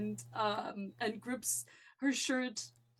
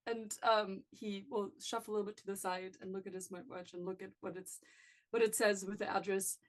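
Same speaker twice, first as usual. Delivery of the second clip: ordinary talk, nothing marked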